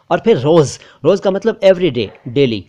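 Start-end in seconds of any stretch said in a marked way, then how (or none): none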